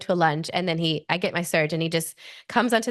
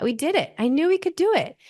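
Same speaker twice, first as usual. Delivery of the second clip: higher, joyful relived triumph; empowered; 9 semitones higher than usual. Retold or imitated. Mother recalling her words after the birth, proudly triumphant. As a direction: exclaim it in proud, rising wonder. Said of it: "I just felt so empowered".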